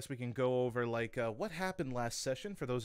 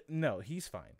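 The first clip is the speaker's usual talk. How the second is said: usual speaking voice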